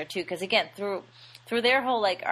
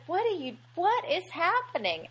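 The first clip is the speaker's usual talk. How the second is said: high-pitched, mildly baffled retelling; mock bewilderment; 10 semitones higher than usual. Co-host voices the wife being shoved, mock bewilderment.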